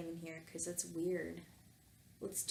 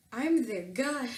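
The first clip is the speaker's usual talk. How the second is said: higher and louder declaration; first try at the line; 5 semitones higher, 6 dB louder, slower than usual. Shown as a demonstration. Bernard reveals himself as god of the turtles, declaring it. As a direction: proclaim it grandly. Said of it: "let me try to do this again".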